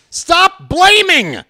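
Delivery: high, slow, loud cry of frustration; exasperated yell. Real, not acted. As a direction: plead at the top of your voice. Exasperated at chat blaming.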